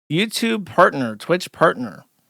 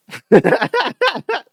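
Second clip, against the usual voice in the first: high laugh; 13 semitones higher, 4 dB louder than usual. Real, not acted. Bursts out laughing at own joke.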